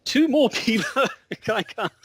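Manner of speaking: put-on high, slow stab at an Australian accent; hammed-up Australian accent, about to crack up. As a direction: overdo the Aussie vowels. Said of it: "very hard to sort of abstract an Australian accent".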